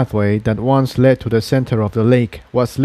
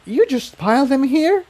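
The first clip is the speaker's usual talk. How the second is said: higher, appalled question; shocked mare. A narrator's reading. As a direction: ask it in disbelief. Incendia voiced, higher.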